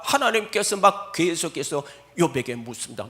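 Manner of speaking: usual speaking voice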